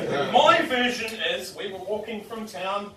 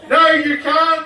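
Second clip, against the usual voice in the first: loud, higher insistent shout; stubborn denial; 10 semitones higher, 13 dB louder, slower than usual. Retold or imitated. Drunk student insisting to the policeman, loud and higher. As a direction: dig in like a stubborn child.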